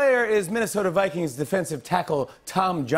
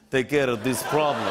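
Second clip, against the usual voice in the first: low, mock Putin voice; lightly voiced Russian boss; Russian accent; 4 semitones lower than usual. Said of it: "Putin turns around and says".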